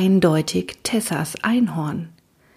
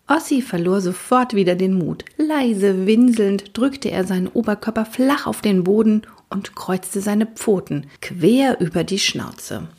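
ordinary delivery

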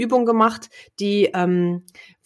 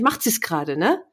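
ordinary talk

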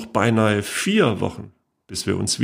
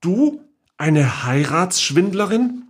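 higher, slower, disbelieving; aghast accusation; 9 semitones higher, slower than usual. A narrator's reading. Niece accusing her great-aunt, aghast and slow. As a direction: spell out the shocked accusation.

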